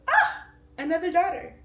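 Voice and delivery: high, loud squeal of delight. Retold or imitated. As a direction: squeal over the new baby. Mom greeting third daughter.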